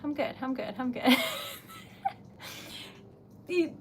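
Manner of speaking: ordinary talk, nothing marked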